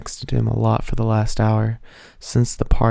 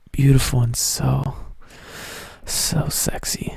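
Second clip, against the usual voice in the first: slow, breathy whisper; sultry whisper; 3 semitones higher, 3 dB quieter than usual. Acted. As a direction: draw it out in a seductive hush. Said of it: "he assured her".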